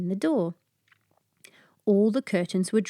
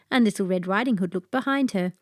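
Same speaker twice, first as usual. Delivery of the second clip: ordinary delivery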